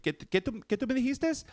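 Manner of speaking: high, challenging mom question; imitated mom's tone. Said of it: "not with that tone".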